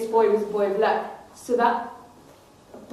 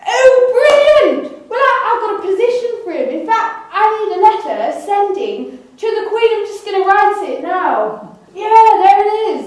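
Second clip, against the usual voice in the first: much higher, louder, rushed delight; overexcited king; 10 semitones higher, 8 dB louder than usual. Acted. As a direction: gush like a scheming king. King delighted, plots letter.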